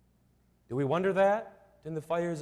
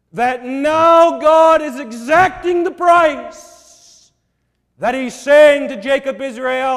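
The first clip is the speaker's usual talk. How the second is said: full-force sermon climax; impassioned preaching; 6 semitones higher, 14 dB louder than usual. Acted. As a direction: preach it hard, drawing it out.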